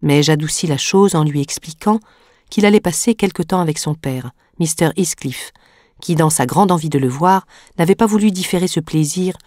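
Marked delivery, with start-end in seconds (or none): none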